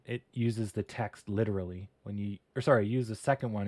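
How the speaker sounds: ordinary delivery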